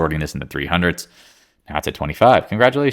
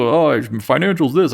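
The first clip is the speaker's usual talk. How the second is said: mocking a bull; 7 semitones higher, 3 dB louder, slower than usual. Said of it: "a lot of people".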